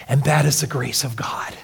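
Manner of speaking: soft unvoiced whisper of wonder; reverent whisper. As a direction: hush the room on the grace of God. Sermon, awe at grace.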